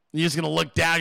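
ordinary talk

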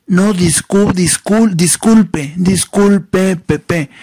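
mock a nasal man who stutters on every word; nasal stutterer. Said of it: "gangoso y tartamudo". (imitación de tartamudo gangoso, voz aguda)

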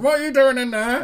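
high, loud mock call through a door. Partner calling to him on the toilet, mock-indignant shout. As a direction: imitate a baffled partner yelling from another room.

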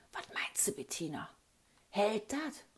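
hushed, unvoiced whisper; whispered question. Kid asks Bettina from hiding, whispering. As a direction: whisper so nobody hears you.

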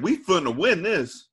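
high, slow mock-Southern "funna"; imitated movie Southern accent. Host quoting the movie's cast, imitated Southern accent. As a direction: drawl it like an actor's poor Southern accent. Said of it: "trying to do a Southern accent".

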